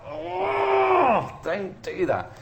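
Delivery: mock pained squeal then protest; pained yelp. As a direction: imitate yourself yelping at the clawing cat. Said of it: "I'll be like".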